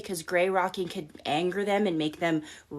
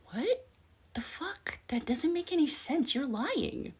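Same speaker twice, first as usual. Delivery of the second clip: high, hushed incredulous gasp; sarcastic shocked voice, high-pitched; mock disbelief; 7 semitones higher, 6 dB quieter than usual. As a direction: act stunned, calling their bluff. Acting out a baffled reaction.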